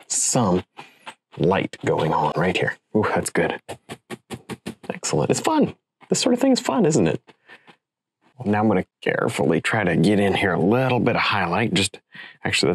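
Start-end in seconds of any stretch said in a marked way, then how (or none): 5.3-7.2 playful high voice. Real, not acted. Painter enjoying the work.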